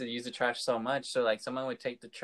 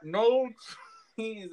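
shouting; 10 semitones higher, 4 dB louder than usual. Retold or imitated. Repeating order louder through screen.

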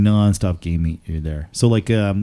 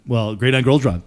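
normal talking voice

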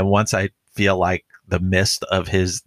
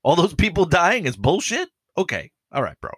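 sarcastic disbelief; 10 semitones higher than usual. Retold or imitated. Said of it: "I was like".

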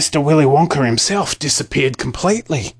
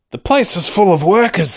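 shouting; 6 semitones higher than usual. A narrator's reading. Townspeople at the locked gates, shouting. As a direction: shout it out in amazement. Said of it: "the people shouted".

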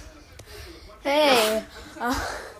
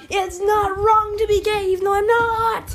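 high voice; 9 semitones higher, 4 dB louder than usual. Acted. Character protests.

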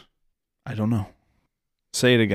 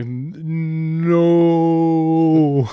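slowed-down playback; 5 semitones higher, 5 dB louder than usual. Retold or imitated. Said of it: "It sounded like it was like".

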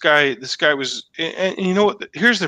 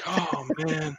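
mumbled excuse; 12 dB quieter than usual. Retold or imitated. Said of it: "So he was like".

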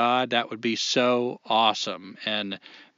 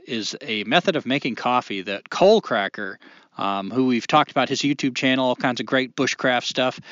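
normal talking voice

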